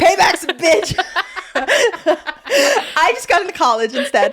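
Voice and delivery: real laughter breaking into the line; laughing. Real, not acted. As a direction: laugh out the punchline. Planning prank, laughing through it.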